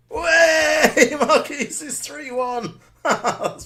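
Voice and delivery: high, loud whoop, then slow laughing relief; real goal celebration; joyful cheer. Real, not acted. Team finally scores.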